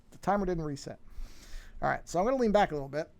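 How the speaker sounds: ordinary delivery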